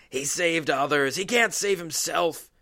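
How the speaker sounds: loud, high, sneering taunt; a loud mocking shout from the crowd; mocking jeer. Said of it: "mocking him".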